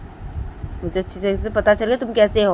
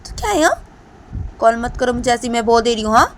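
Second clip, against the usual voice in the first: put-on higher, louder angry girl; angry scolding; 4 semitones higher, 8 dB louder than usual. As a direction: tell him off and hang up. Deepanta angry again.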